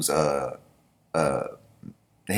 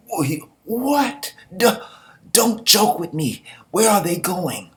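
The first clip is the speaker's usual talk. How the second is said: shocked disbelief; 10 semitones higher, slower than usual. Acted. Voicing the shocked general Meron.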